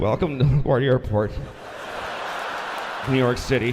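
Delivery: winded, low pilot announcement; out of breath. Acted. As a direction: announce it between heavy breaths. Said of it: "He's totally out of breath".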